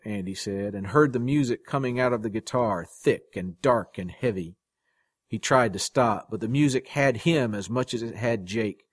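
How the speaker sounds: usual speaking voice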